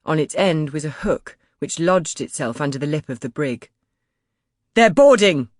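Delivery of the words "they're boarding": loud, high alarm call; shouting. A narrator's reading. Crompton warning the crew of boarders, loud alarmed shout. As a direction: warn the whole deck. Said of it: "shouted Crompton".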